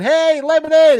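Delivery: high, loud mock crowd call. Fans spotting [name] at the show, calling out high and loud. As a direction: call out to him like a fan in the crowd. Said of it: "Everybody was going".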